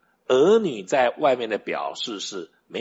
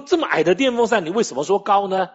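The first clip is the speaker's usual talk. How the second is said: pitched-up, mildly indignant comeback; insistent retort; 6 semitones higher, 4 dB louder than usual. Retold or imitated. Father countering his son about the fan, insistent retort. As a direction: throw the question back in a retold squabble. Father throws child's words back.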